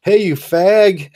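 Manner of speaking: hammed-up taunt shouted across the yard; mock neighbor yell. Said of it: "your neighbor yells out".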